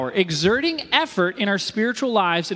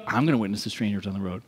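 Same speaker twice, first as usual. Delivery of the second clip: low, quick, softer echo; mock-determined copycat, fast and much lower; copycat resolve; 10 semitones lower, 5 dB quieter, faster than usual. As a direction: drop the pitch and rattle it off quietly. Copying friend, answering line.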